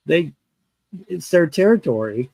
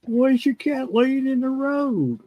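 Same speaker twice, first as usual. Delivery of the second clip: slow, higher mocking quote; meth head voice; 12 semitones higher than usual. Retelling the meth head's question, slow mocking drawl. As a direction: mimic the dazed question, drawn out. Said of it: "what kind of question is that".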